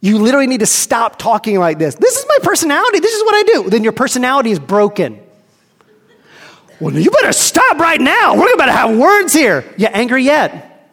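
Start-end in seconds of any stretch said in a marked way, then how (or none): 1.9-3.7 high, quick, indignant insistence; defensive flesh. Acted. 6.8-9.6 higher, louder mock threat; threatening flesh.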